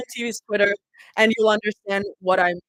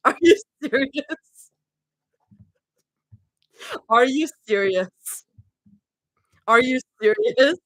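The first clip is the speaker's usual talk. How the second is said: high, slow, laughing disbelief; 4 semitones higher than usual. Real, not acted. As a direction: drag it out in laughing shock. Repeated disbelief at chat.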